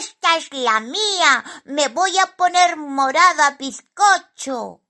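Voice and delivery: high, loud cartoonish glee; high-pitched greedy excitement; gleeful little character. A narrator's reading. Simón gloats over the cake.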